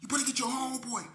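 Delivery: urgent threat to the driver; telling K C to get his dog; warning the driver. Retold or imitated. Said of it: "I'm telling K C".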